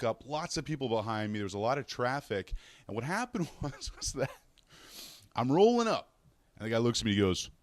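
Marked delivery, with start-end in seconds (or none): none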